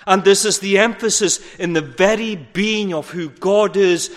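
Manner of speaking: ordinary talk, nothing marked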